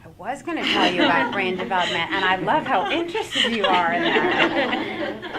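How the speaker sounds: loud, laughing admission. Real, not acted. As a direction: laugh through the words. Laughing at own slip.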